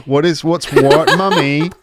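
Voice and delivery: put-on high, loud kid voice through laughter; puzzled child. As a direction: play a baffled kid, laughing. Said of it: "And then your kid goes".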